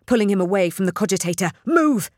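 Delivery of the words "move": loud, high shouted order; sharp burst of urgency; spat command. A narrator's reading. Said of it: "she spat at Tekawa".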